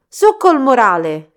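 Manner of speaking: rally a gloomy friend; exclaiming. A narrator's reading. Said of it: "esclamò Benno".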